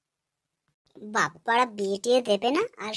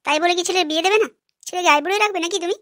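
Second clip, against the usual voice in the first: heated protest; 6 semitones higher, 4 dB louder, faster than usual. Acted. Wife argues for son's marriage.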